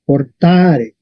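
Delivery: "portare" with a long, stressed middle A. Shown as a demonstration. Teacher shows students where the force falls, stressed A in "portare". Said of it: "la fuerza está en la A".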